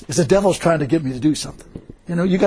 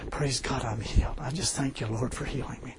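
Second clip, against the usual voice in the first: much softer and lower; strained quiet prayer; 6 semitones lower, 13 dB quieter than usual. Retold or imitated. Preacher retelling his prayer while in pain, strained quiet praise. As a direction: praise under the breath, low and small.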